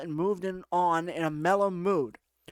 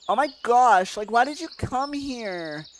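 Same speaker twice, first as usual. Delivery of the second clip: put-on irritated girl; 4 semitones higher, 4 dB louder than usual. Alyssa confronts him at the club, exasperated higher voice. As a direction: open loud and high, then stretch the question.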